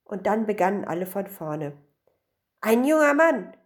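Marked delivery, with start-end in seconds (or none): 2.6-3.5 high, loud insistence; raised, emphatic assertion; arguing. A narrator's reading. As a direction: shout your claim in the quarrel. Everyone repeats their quarrelling claims.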